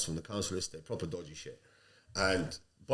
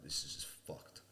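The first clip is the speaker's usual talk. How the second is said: muttered thought; 5 semitones lower, 13 dB quieter than usual. Retold or imitated. Muttered retold thought about recruitment.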